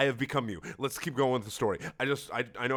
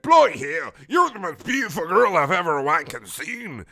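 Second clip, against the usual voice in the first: loud, high British-ish Wheatley; 6 semitones higher, 11 dB louder than usual. Acted. Reading bad fanfic aloud, loud Wheatley voice. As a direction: belt it like a goofy robot.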